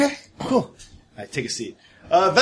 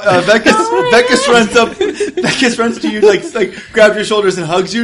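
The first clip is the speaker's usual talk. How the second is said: ordinary talk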